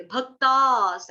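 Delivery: भक्तास slow, स joined to भक्ता; slow demo of भक्तास; joined bhaktas. Shown as a demonstration. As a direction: link भक्ता into स. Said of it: "ऐसे करके दिखा देती हूँ".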